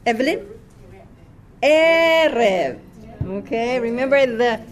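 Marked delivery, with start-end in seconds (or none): 1.6-2.8 "Erev" with a long, loud, raised first syllable; first-syllable stress. Shown as a demonstration. Teacher correcting the class's reading, "Erev" stressed on the first syllable. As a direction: lean hard on the E of "Erev". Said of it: "the accent is on the first syllable".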